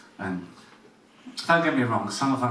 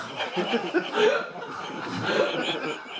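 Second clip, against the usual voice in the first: laugh. Real, not acted. Laughs after punchline.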